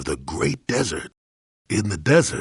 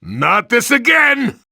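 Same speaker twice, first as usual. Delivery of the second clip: much higher, louder and slow, fed up; exasperated shout; 7 dB louder, slower than usual. Acted. Old Jedi groaning as another fight starts, exasperated shout. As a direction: yell it like a weary fighter dragged back in. Old man enters combat.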